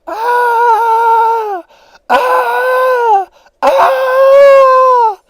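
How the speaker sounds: hammed-up terrified shrieks, very high and loud; mock screaming. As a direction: scream like a man swept downstream. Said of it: "he's just screaming and crying".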